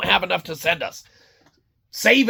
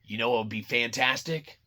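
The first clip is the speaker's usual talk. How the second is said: low scheming executive; 7 semitones lower, 6 dB quieter than usual. Retold or imitated. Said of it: "they're like".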